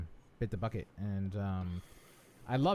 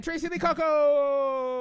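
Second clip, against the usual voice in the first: drawn-out, high announcer's build; excited shout-out; 12 semitones higher, 7 dB louder, much slower than usual. Acted. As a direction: stretch the name into a big high call. Hamming up a chat shout-out.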